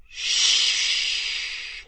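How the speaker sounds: drawn-out whispered shush; sustained voiceless shushing; long crowd hiss. A narrator's reading. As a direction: hiss it like sea snakes. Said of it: "hissed the crowd like sea snakes".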